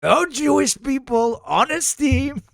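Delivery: high, drawn-up mock-indignant verdict; accusing politician character. Mock accusation to Xi about his team, high indignant politician voice. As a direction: pronounce it like an outraged blustering leader.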